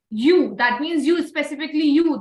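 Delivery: each "you" stretched and pushed; stress on you. Shown as a demonstration. Teacher explains who is told, stressing "you". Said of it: "So I'm telling just you".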